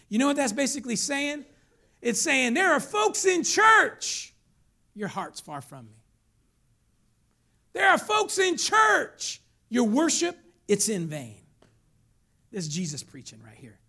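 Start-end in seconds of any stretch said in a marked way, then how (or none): 0.1-6.0 high, loud, stretched declaration; full-force preaching. Real, not acted. Preacher explaining the rebuke to churchgoers, full force. Preacher drives the point home. 7.7-11.4 high, loud, slow proclamation; forceful rebuke. Real, not acted. Preacher rebuking churchgoers over their worship, loud and forceful.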